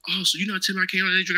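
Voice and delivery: high, quick hopeful gotcha; surprised realisation. Retold or imitated. Marine realising he may still drink, mock surprised. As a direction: jump on the loophole, surprised. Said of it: "he was like".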